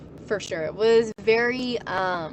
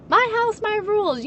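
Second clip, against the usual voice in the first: high, loud my house my rules; mocking parent; 12 semitones higher, 5 dB louder than usual. Parents laying down house rules, high mocking voice. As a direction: parrot the parent scornfully.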